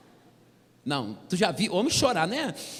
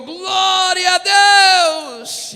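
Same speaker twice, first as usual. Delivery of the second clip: much higher, loud praise shout; church shout; 12 semitones higher, 12 dB louder, much slower than usual. Retold or imitated. Worshipper beside him shouting praise, loud. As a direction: yell it out in praise. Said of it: "Alguém do meu lado gritou".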